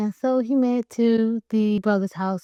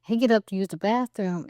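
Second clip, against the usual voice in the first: ordinary talk, nothing marked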